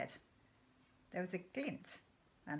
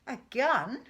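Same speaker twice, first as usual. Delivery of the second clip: louder, scornful "A gun?"; disgusted cry; 5 semitones higher, 10 dB louder than usual. Acted. Father scoffing at Danny's question, loud and disgusted. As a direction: spit it out in disgust. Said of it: "he cried, disgusted".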